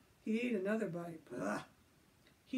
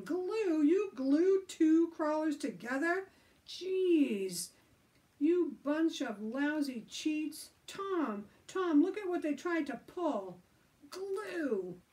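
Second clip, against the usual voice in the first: high, loud, slow outrage; furious at the cheaters; outraged shouting; 8 semitones higher, 7 dB louder, slower than usual. Acted.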